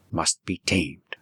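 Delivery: ordinary delivery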